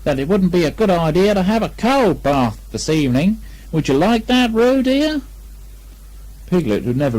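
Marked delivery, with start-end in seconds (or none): 0.0-5.2 higher, sing-song motherly voice; a hint of a coaxing mother; lightly voiced Kanga. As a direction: tease him gently. Kanga teasing Piglet.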